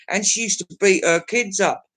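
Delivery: usual speaking voice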